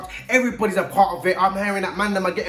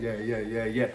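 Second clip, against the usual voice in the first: imitating a young inmate with a deep voice, much lower and softer; 10 semitones lower, 8 dB quieter than usual. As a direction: drop way down like a big hench lad answering. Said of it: "Why have you got a deep voice?".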